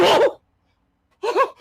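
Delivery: high, loud panicked scream for her husband. Acted. Wife finds boots by the cow, screaming for husband. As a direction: shriek for the husband in terror. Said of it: "She began screaming".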